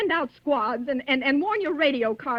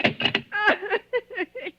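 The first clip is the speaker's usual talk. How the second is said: hysterical laugh; 8 semitones higher than usual. Acted. Hysterical after slamming phone.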